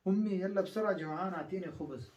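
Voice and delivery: put-on fast high child voice begging for خبز; impatient hungry child. A hungry child demands bread from his mother, impatient. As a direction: rush it like a starving kid. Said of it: "إذا بالعربي قلت،".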